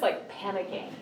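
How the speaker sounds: usual speaking voice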